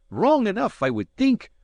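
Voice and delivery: lightly voiced young lass; a hint of a Scots maid. A narrator's reading. Maid's voice in story.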